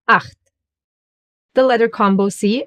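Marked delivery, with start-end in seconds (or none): 0.1-0.4 "acht" with a harsh ch from the throat; German "acht", CH formed back in the throat; throaty German ch. Shown as a demonstration. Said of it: "exactly like here".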